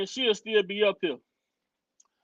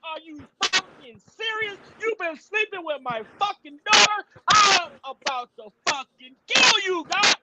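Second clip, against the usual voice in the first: furious father; 5 semitones higher than usual. Retold or imitated. Father furious at daughter's abuser.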